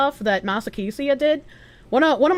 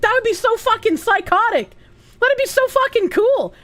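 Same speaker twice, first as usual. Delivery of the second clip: excited gush; 11 semitones higher than usual. Real, not acted. Gushing over game idea.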